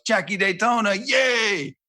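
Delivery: high, whooping fan impression; mock fan cheer. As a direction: imitate a gushing commenter, voice shooting up. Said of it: "someone goes".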